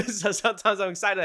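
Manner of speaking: excited words through a real laugh; laughing excitedly. Real, not acted. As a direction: laugh it out, giddy and keyed up. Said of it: "I, I'm excited".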